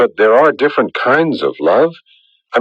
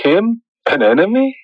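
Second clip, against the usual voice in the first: sharp high startled question; shocked disbelief; 10 semitones higher than usual. Acted. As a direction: caught completely off guard. Shocked Kim is now an enemy.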